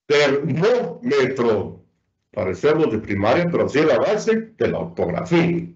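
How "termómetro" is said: Termómetro slowly, leaning on the syllable MÓ; stress on MÓ. Shown as a demonstration. Teacher giving examples of prosodic accent, slow stress on MÓ in Termómetro. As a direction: stretch out Termómetro to show its stressed MÓ. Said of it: "¿Dónde está el acento prosódico?".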